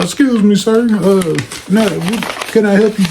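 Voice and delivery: stiff, courteous school cop; polite officer. Retold or imitated. School police officer addressing intruders.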